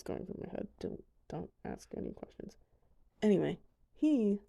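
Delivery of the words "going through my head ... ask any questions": whispered aside. Real, not acted. Embarrassed aside after laughing.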